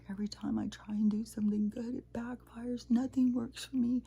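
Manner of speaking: high, wailing retold lament; imitating crying woman. Robbed woman despairing that good deeds backfire, imitated as crying. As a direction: pitch it well up in a mock tearful complaint. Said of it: "and she was crying, like".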